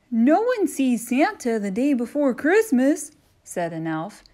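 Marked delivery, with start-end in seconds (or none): 0.1-3.1 high, louder bossy elf; christmas elf. Acted. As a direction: brush off the visitor. Elf voiced.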